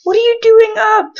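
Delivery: high, loud startled question to the Sim; surprised. Real, not acted. Player scolding her Sim for being awake, startled and loud.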